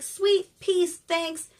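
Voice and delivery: high, airy kiss-off from a princess; breezy mock thanks. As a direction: toss off a high-pitched thanks. Princess breezily takes ball and leaves.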